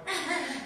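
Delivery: high little cackling "eh, eh, eh"; high mock demon giggle; demonic cackle. Shown as a demonstration. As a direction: cackle it out like a possessed woman. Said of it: "Doa ngekek itu kan awal".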